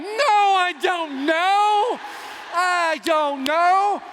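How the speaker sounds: high, very drawn-out protest; mock wailing. Husband answering "you should have known", high drawn-out mock wail. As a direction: stretch each word into a hammed-up wail.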